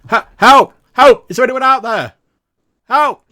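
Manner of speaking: high, loud panicked shouting; desperate cries for help. Acted. GM voices trapped prisoners crying.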